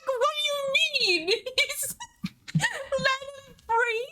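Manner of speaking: very high, slow squeaky exclamations; high squealing disbelief. Real, not acted. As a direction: squeal at the wedged hamster. Squealing about hamster stuck behind.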